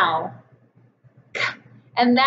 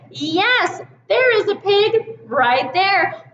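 normal talking voice

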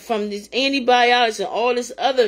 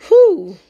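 loud exhale of awe; 7 semitones higher, 8 dB louder than usual. Real, not acted. Overcome with feeling.

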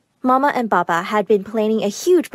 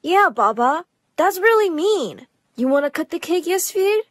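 put-on high kid-brother voice; young brother; 7 semitones higher than usual. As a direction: voice a child speaking up, pitched high. Brother speaks in the story.

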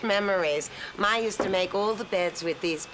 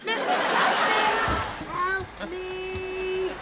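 frantic call for help; 8 semitones higher, much slower than usual. Acted. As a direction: call out even harder, panicking. Stuck in the closet, calling for help.

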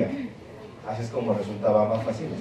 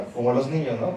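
ordinary delivery

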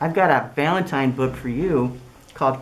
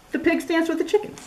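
high, far squeaky line; high animal voice; 14 semitones higher, 3 dB quieter than usual. Acted. Pig voiced while dancing.

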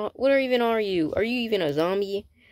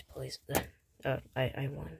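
normal talking voice

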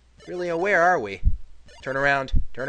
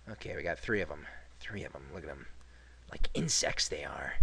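much softer, lower muttering; 15 dB quieter than usual. Real, not acted. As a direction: mutter under breath at enemies. Muttering at enemies.

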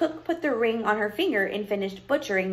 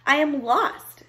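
higher-pitched princess; pleading princess; 5 semitones higher, 4 dB louder, slower than usual. Acted. Lost princess appeals to the witch, pleading. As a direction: announce your trouble in a high voice.

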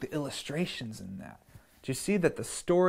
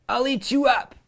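higher, louder bratty threat; defiant Max; 10 semitones higher, 7 dB louder than usual. Acted. Max defying his mother, loud cheeky threat. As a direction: snap it back like a cheeky kid. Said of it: "And Max said".

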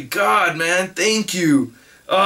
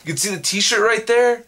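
ordinary talk

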